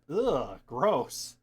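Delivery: grossed out, spit out gross; annoyed ugh. Real, not acted. Disgusted by crusty neck board.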